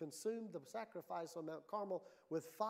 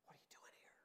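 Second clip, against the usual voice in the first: breathy whisper, almost no voice; a still small voice's hushed whisper; 23 dB quieter, much faster than usual. Retold or imitated. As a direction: whisper it like a secret from God. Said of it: "A still small voice that says".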